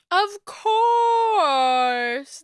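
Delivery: loud bright fake cheer; forced cheerful. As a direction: cover disappointment with cheer. Mom covering disappointment.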